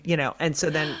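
usual speaking voice

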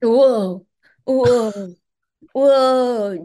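loud booming mad scientist; theatrical villain exclaim; mad scientist whoa. Acted. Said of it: "doing a mad scientist laugh".

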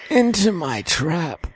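slow, high gloating villain; anime villain. Pretend. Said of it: "like an anime villain".

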